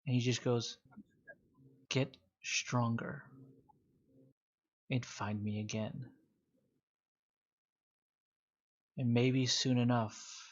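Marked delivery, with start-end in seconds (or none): 1.9-10.4 slow, deliberate villain's farewell; measured parting challenge. Acted. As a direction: draw out each phrase with pauses. Villain's parting words to beaten rival.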